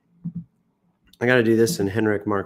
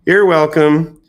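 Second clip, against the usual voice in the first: smug; 6 semitones higher, 11 dB louder, slower than usual. Pretend. Mock self-congratulation.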